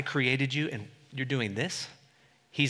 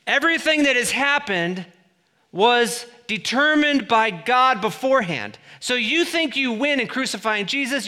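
loud, high, driving sermon build; preaching at full force; 9 semitones higher, 9 dB louder than usual. Acted. Preacher driving the point home to the church, loud and high. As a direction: hammer it home to the congregation.

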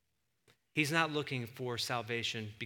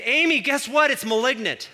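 high, loud blurt down the phone; retold loud announcement; 10 semitones higher, 14 dB louder than usual. Husband phones his wife with biopsy news, loud retold blurt. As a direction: re-enact blurting out big news, pitched way up. Phoning wife with mistaken diagnosis.

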